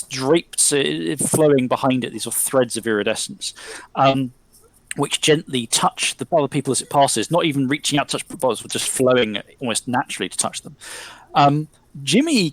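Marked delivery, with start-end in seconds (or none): none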